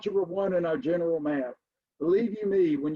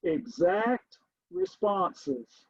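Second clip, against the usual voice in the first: very slow, each word drawn out; slow dictation; much slower than usual. Shown as a demonstration. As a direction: dictate it slowly as you write. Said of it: "let me write this down".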